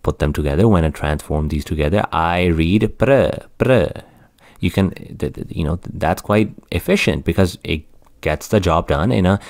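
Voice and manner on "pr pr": "pr" said slowly as one sound; cluster pr. Shown as a demonstration. Teacher sounds out the cluster for students. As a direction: blend p and r together in "pr". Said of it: "makes a sound of p".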